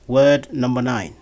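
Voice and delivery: ordinary delivery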